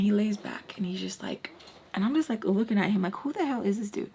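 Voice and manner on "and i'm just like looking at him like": usual speaking voice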